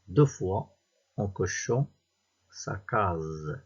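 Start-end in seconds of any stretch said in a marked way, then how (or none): none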